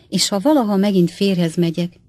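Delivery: ordinary delivery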